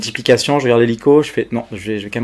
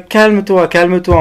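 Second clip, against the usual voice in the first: higher, louder mock soothing operator; imitating the operator's calm-down, higher and louder; 7 semitones higher, 5 dB louder than usual. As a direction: tell a panicking caller to calm down. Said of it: "Elle me dit".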